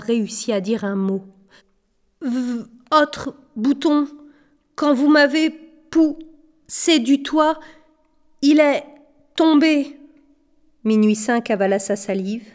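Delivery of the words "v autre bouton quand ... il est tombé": high, slow, broken by pauses; terrified stammer. A narrator's reading. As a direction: force the words out in terror. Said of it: "Elle était tellement terrifiée". (bégaiement terrifié, haut et haché)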